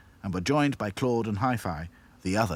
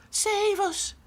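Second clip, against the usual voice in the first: very high, pleading doll voice; begging. A narrator's reading. Lucy pleading with the princess dolls, begging. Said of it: "begged Lucy".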